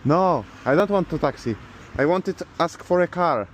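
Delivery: ordinary talk, nothing marked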